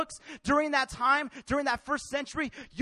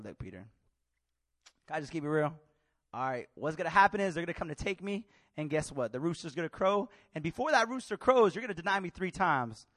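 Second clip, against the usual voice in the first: calm Jesus; 6 semitones lower than usual. Acted. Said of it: "Jesus is like".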